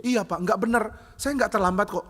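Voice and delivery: high, indignant denial; acted aggrieved insistence; sulky protest. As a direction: plead your case to the pastor. Sulking pianist protests to pastor.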